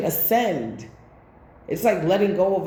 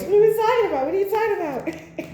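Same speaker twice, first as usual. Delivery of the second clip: Medea voice; 12 semitones higher, faster than usual. Retold or imitated. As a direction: do Medea going off, then crack up. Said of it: "Like my Medea voice".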